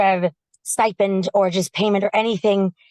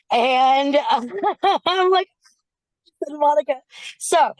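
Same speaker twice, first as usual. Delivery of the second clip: laughing; 4 semitones higher, slower than usual. Real, not acted. Overwhelmed fan laughing at host.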